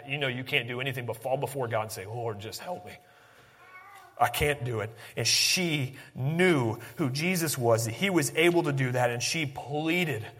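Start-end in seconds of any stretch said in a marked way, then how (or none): 2.0-4.9 very soft, low, spent prayer; acted helpless plea, barely voiced; end-of-rope plea. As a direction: pray quietly as if out of options. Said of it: "the very end of your wits".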